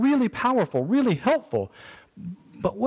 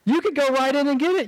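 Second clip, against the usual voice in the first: high, disbelieving mock protest; husband's mock outrage at a milk run; incredulous retort; 6 semitones higher, faster than usual. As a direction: fire back like the request is absurd. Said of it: "I'm like".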